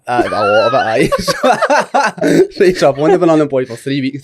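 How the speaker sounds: real laughter breaking through the answer; laughing. Real, not acted. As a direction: laugh through the words, then carry on. Laughing at masturbation joke.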